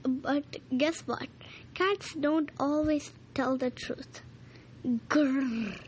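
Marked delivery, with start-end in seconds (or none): 5.1-5.8 a short growl in a dog voice; doggy growl. Acted. Dog hunting the cat.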